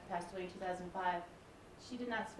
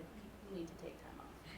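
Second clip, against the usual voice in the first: hushed, near-whispered retold advice; gentle insistence, almost under the breath; calm, patient; 13 dB quieter than usual. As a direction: repeat it very softly and patiently, imitating a calm doctor. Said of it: "very calmly and patiently said".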